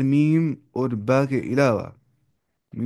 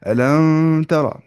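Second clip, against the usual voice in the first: slow, louder, stretched अलम तरा; drawn-out wrong way; 4 semitones higher, 7 dB louder, much slower than usual. Shown as a demonstration. Teacher showing students the wrong way, drawn out. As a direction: drag out अलम तरा to show the mistake. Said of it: "ऐसे नहीं".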